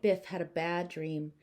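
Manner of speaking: ordinary delivery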